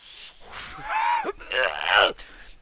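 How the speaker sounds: exaggerated high choking cough; imitated hacking cough. Newbie taking his first hit, hammed-up hacking. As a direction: act out coughing up a lung. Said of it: "he fucking throws up a lung".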